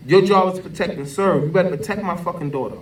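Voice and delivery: ordinary delivery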